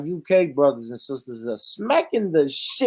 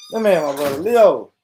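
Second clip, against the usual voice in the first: normal talking voice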